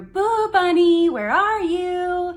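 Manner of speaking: loud, high, drawn-out sing-song call; mom calling out. Retold or imitated. Daughter imitating mom calling her in the grocery store, loud sing-song holler. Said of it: "and I would hear".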